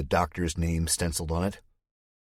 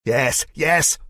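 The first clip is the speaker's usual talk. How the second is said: loud, higher gleeful yelping; overexcited; 8 semitones higher, 7 dB louder than usual. Acted. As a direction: bounce like an overexcited puppy. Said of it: "jumping up and down ... like an overexcited puppy".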